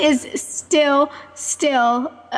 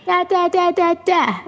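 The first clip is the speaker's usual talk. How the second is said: put-on higher playful baby babble; imitating baby babble; 6 semitones higher, slower than usual. Daughter babbling every morning, higher playful baby voice. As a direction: babble it like a toddler each morning. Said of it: "It's every morning".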